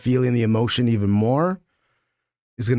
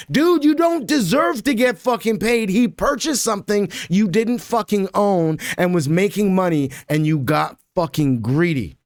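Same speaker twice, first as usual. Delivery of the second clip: heated mock outrage; 9 semitones higher than usual. Reading heated text reply aloud.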